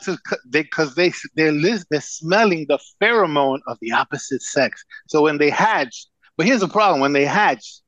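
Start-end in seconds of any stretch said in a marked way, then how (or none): none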